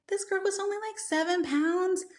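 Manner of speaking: high-pitched, mild disbelief; shocked. Retold or imitated. Mother comparing her two babies' birth weights, mildly shocked. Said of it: "like, shocked".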